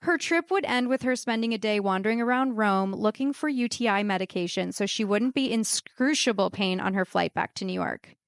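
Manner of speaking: usual speaking voice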